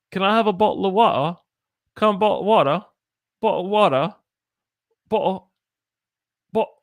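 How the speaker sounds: high, loud, fast mock British "bottle of water"; mock British meme. Retelling a TikTok meme to students, hammed-up British. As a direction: imitate the TikTok Brit stuck on "bottle". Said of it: "TikTok where it's like, like, English in the future".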